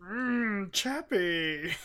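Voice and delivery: silly drawn-out creature moan into the name; hum, then croon the name in a goofy voice; badly faked robot character voice; Chappie. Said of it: "None of this has been what Chappie sounds like".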